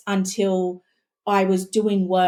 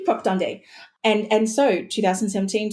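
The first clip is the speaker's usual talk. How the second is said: normal talking voice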